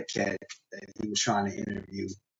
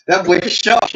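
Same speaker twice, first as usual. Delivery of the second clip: laughing; 8 semitones higher, 10 dB louder, slower than usual. Real, not acted. Laughing at the cut fingers.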